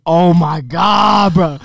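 drawn-out, loud "oh my God, bro"; mortified outburst. Retold or imitated. Reliving the gym embarrassment to friends, slow loud cringe. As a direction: stretch it slowly, cringing loudly. Mortified as the dude taps her.

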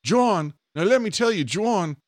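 high Australian infomercial host, punching the name; Australian accent. Acted. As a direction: pitch it high in mock Australian, repeating the name. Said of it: "Australian accent".

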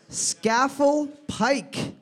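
slow, drawn-out name; slow careful reading. Shown as a demonstration. Quiz host reading a hard place name slowly. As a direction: spell it out with your voice slowly. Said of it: "S C A F E L L".

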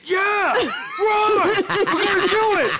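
high, laughing shout of a hyped singer; laughing mock scream. Imitating Jesse screaming as the band fades away, laughing. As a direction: scream it high while cracking up. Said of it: "Jesse starts going".

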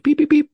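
high electronic beep sounds; imitated beeping. Copying the broken taser's beep, high and electronic. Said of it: "the taser beep".

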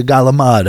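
galamad with a hard g and dropped final vowel; Staten Island pronunciation. Shown as a demonstration. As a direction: attempt galamad, Italian-American style. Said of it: "don't have it in me to say".

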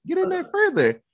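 mock creepy granny coaxing; grandma voice. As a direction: push it high and sugary, insisting. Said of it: "She's like".

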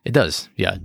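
ordinary delivery

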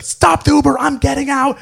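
high, loud imitation of a drunk friend; mock hysterical yell. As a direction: yell it high-pitched, demanding to be let out. Drunk friend demands exit, retold.

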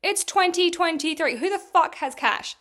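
fed-up yell at the shop staff; irritated outburst. Retold or imitated. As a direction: blast it back in disbelief. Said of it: "I was so irritated".

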